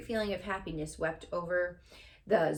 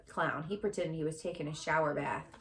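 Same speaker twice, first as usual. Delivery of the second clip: ordinary delivery, nothing marked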